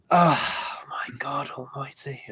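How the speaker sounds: slow, hushed exclamation of disbelief; agonised whisper. Real, not acted. Judge agonising over a close debate verdict, whispering. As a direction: whisper it slowly, weighed down by the decision.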